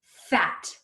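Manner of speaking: "Fat" short and whole; fast like a word. Shown as a demonstration. Teacher finishes blending for children, "Fat" fast as a word. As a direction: say "Fat" quickly in one go. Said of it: "say it fast like a word".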